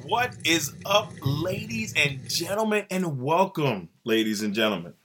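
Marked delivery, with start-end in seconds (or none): 0.0-3.9 higher, slow, stretched-out showman call; hype announcer intro. Acted. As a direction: draw it out like an arena announcer. Hyping the podcast opening.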